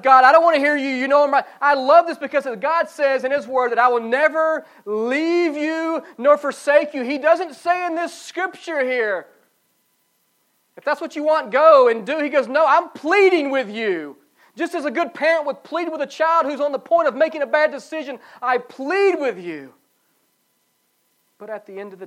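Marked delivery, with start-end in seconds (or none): 0.0-1.5 defiant believer. Retold or imitated. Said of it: "getting to the point of". 1.6-19.7 loud, higher building climax; preaching. Acted. Pastor pleading with congregation at sermon climax, fervent.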